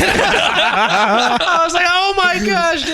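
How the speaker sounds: high, laughing through the words; giggling. Retold or imitated. Friends hear him recall laughing for half an hour. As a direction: keep giggling as you talk. Retelling stoned laughing fit.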